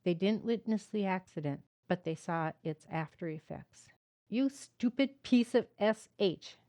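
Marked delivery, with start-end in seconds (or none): 4.3-6.6 loud, higher furious insult; shouting. A narrator's reading. Father shouting at his stunned son, furious. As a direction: yell at the boy in rage. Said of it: "Dad shouted at the stunned boy".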